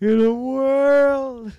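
high, loud, slow trailer line; movie trailer voice. Pretend. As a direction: boom it like a trailer.

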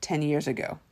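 dry, quiet, low-key delivery; deadpan. Retold or imitated. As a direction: drop it completely deadpan. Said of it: "with a deadpan voice says".

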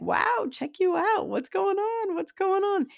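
high sing-song mock amazement at yourself; mock self check-out. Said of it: "I check myself out. I'm like".

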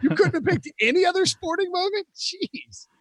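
high, disbelieving complaint; exasperated. Real, not acted. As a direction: pitch up in mock outrage. Mock outrage at the meme.

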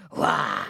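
low roaring creature; lion roar. A narrator's reading. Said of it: "The griffin roared like a lion".

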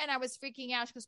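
usual speaking voice

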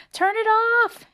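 loud high panicked yell; Mother yells at the broken machine. A narrator's reading. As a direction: shout over the noise. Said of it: "yelled Mother".

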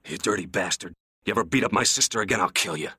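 out of breath, angry threat. A narrator's reading. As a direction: threaten him while catching your breath. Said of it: "his chest heaving".

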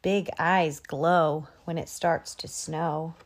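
ordinary delivery

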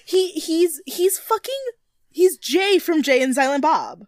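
high, slow excited blurt; excited retold outburst. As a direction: blurt it out, thrilled. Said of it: "and I was just like".

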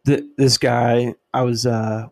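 ordinary delivery

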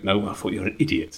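laughing, lightly put-on Suffolk accent; the Suffolk attendant, said through a laugh. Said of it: "This is my random Suffolk voice".

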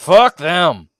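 coach's rallying yell. Retold or imitated. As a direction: bellow it like an outraged coach. Coach's line as running punchline.

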